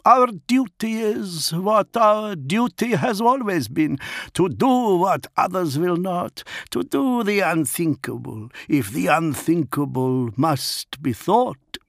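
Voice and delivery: grim pack leader declaring the unthinkable; Fafnir the grim executioner. Acted. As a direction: intone it like an oath.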